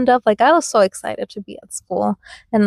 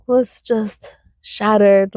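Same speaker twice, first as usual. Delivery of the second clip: ordinary delivery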